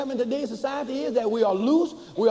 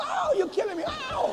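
high hammed-up childish wail; retelling his own childhood howl, much higher; mock child wailing. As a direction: ham up the kid howling under a whipping. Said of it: "I'm screaming and hollering".

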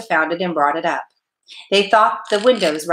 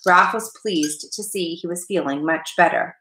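usual speaking voice

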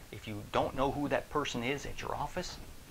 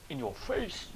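higher, gloating in your face; triumphant jab; 7 semitones higher, slower than usual. Acted. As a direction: gloat over the doubter. Triumphant retort.

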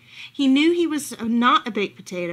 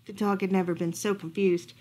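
plain reading voice

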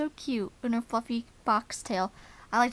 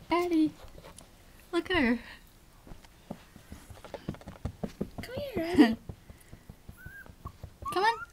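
much higher coaxing call to the chihuahua; pet talk; 8 semitones higher than usual. Real, not acted. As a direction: coax the little dog over. Calling the chihuahua.

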